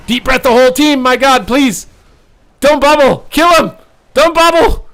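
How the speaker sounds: very high, loud begging; nerve-wracked pleading yell; desperate shouting. Real, not acted. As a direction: scream instructions at the screen. Pleading during decisive moment.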